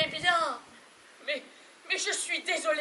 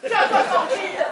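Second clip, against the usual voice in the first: loud, high scolding command; sharp order; 4 semitones higher, 8 dB louder than usual. Acted. Wife stops husband going to fight Bobby, sharp order. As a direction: rein him in with a shout.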